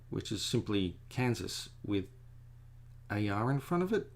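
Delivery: normal talking voice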